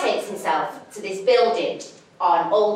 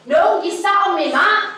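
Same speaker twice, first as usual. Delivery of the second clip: louder and higher, firmly repeating it; insistent; 8 semitones higher, 5 dB louder than usual. Retold or imitated. To the hippie who won't move, insistent. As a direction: raise the voice, insisting this time. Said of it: "He did it again".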